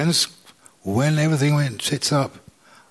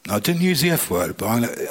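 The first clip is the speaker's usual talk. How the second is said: ordinary delivery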